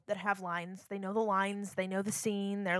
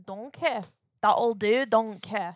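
louder, put-on Florida swamp accent; imagined Florida accent; 7 dB louder, slower than usual. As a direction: play a rural Florida old dude. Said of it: "What would we sound like".

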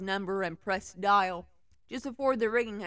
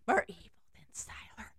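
whispering; 3 semitones higher, 4 dB quieter than usual. Real, not acted. Whispered joke.

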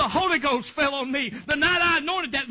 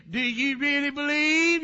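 child imitating preacher. Little girl challenging the sick to believe, lightly imitated preacher. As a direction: imitate a child copying her pastor's preaching. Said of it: "she'd tell 'em just like I did".

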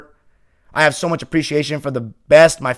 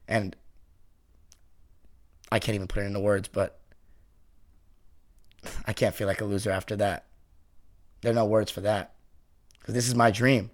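moved and sincere; 7 semitones lower, 11 dB quieter than usual. Real, not acted. Moved thanking fans after playoffs.

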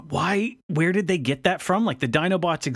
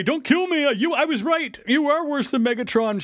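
high-pitched, hammed-up pleading; mock panic; 10 semitones higher than usual. Sumdac begs the robot for his life, high mock panic. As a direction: imitate a frightened man begging as he flees.

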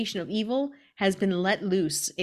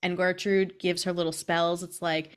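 ordinary delivery

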